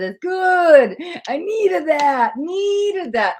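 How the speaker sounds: high, loud, drawn-out relish; emphatic, elongated delight; gushing comment read-out. Acted. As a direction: belt out the grateful comment, stretching the vowels. Reads a grateful comment aloud.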